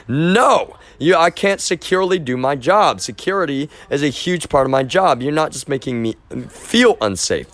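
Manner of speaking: high-pitched, emphatic refusal; retold protest. Employee objecting that the job can't be done securely, in protest. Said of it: "well, protest".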